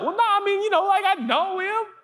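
very high, squirming excuse; sheepish backpedaling. Acted. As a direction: wriggle out of it.

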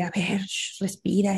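soft, hushed, calming; hushing teacher. Retold or imitated. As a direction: shush her gently into breathing. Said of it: "me presionó así de". (maestro susurrando con calma)

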